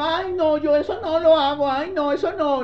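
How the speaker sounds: high, put-upon "ay, no" of someone dodging; mocking a lazy excuse; whiny refusal. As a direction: mimic a reluctant churchgoer brushing it off. Mimicking someone refusing to change.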